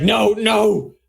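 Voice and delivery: high, acted cry of protest; a villain's alarm as the plan collapses. Inquisitor cries out as the spell breaks, alarmed protest.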